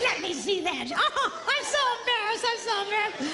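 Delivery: high, flustered mock wife voice. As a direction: squeak it out, embarrassed. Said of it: "She doesn't sound like that".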